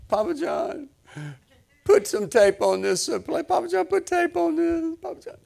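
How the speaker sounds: very high little-kid voice, imitated; pleading grandkid. Grandkids begging Papa John for tape, high pleading imitation.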